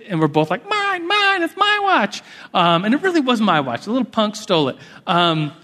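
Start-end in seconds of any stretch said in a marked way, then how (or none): 0.7-2.2 put-on very high, whiny child claim; kids squabbling. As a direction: squabble like a little boy fighting over a toy. Two kids claiming the watch.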